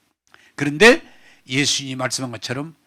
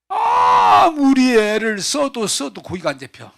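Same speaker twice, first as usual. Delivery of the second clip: loud, high and slow, straining with frustration; frustrated shout; 8 semitones higher, 5 dB louder, slower than usual. Acted. A preacher voicing Peter's fishless night, frustrated shout. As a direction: bellow out the hopelessness.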